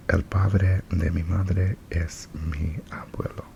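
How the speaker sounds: breathy ASMR whisper. Real, not acted. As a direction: whisper it close to the mic.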